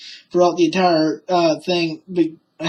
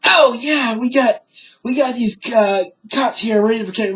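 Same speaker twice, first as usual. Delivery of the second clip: imitating mother, higher and pitched up; a touch of retold eagerness; mock excited relief; 5 semitones higher than usual. Retelling mother greeting the cops, mock excited.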